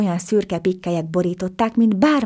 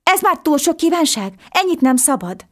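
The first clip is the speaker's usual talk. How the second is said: frightened; 10 semitones higher, 4 dB louder than usual. A narrator's reading. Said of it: "kérdezte a kisfiú ijedten".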